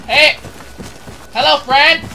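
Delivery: high, loud eager greeting; excited calling out. Real, not acted. Player greeting a teammate, excited shouts. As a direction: call out brightly to a pal.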